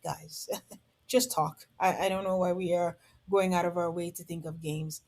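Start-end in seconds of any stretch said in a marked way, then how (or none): 0.0-1.7 exasperated. Retold or imitated. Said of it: "and I'm like".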